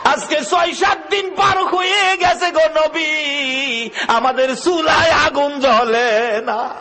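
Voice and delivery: high, drawn-out chanted lament; retold tearful plea. Hungry children tell the Prophet of poverty, tearful chanted plea. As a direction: wail it slowly as imitated weeping children. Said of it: "বাচ্চাগুলো কান্দে আর বলে".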